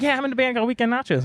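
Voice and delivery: quick, high and breezy; fast high casual voice. Retold or imitated. Singer introducing his band, fast high breezy voice.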